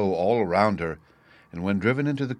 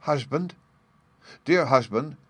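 higher-pitched, tentative call to husband; a wife's mild entreaty, pitched up; gentle wifely appeal; 6 semitones higher, 3 dB louder than usual. A narrator's reading. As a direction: appeal to him hesitantly. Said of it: "said his wife in a low voice".

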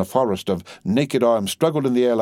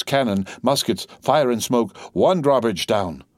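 ordinary delivery

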